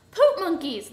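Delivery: exasperated outburst; frustrated scream. A narrator's reading. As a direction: yell it in frustration. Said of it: "screamed in frustration".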